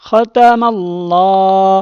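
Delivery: খতামাল্লাহ drawn out slowly, syllable by syllable; slow tajweed demo. Shown as a demonstration. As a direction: stretch খতামাল্লাহ slowly with the long a held. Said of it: "মাল, ল". (ধীরে টেনে খতামাল্লাহ উচ্চারণ)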